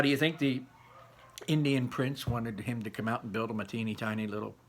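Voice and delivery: plain reading voice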